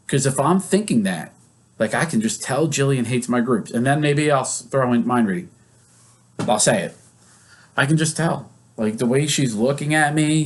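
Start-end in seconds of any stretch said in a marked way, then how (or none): none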